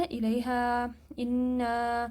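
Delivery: الیہا and انا with a smooth, soft long alif; no catch, just a gentle glide; soft recitation of the alif; softly. Shown as a demonstration. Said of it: "نرمی سے پڑھنا ہے".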